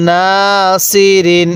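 না held long, then level সি রিন; recitation model. Shown as a demonstration. Teacher showing how to read, drawn-out না সি রিন. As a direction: stretch না and chant সি রিন evenly. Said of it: "এরকমভাবে শিখব".

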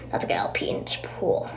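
sneaky low whisper. Pretend. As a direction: whisper it like a secret. Sneaky confession about peeing.

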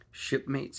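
rallying call pitched well up; cried out. A narrator's reading. Silver rallies his frightened shipmates, a loud high cry. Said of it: "he cried".

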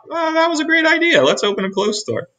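high, quick thrilled voice; eager little mole rat; excited idea. Acted. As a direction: gasp of a great idea. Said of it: "Wilbur thought".

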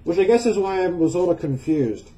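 muffled behind mask; speaking through respirator cartridges. Real, not acted. Seen 0: Cartridge respirator covers mouth and nose throughout; reference frames show it hand-held.

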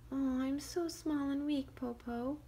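high, fake frail little voice; pretend weakness. Shang faking weakness to the wolf. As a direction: play helpless. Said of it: "Shang pretended".

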